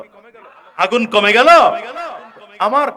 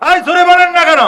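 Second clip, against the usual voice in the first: high, loud shout at the crowd; loud scolding call; 10 semitones higher, 10 dB louder than usual. Real, not acted. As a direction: bark the demand to speak up. Scolding crowd for not answering.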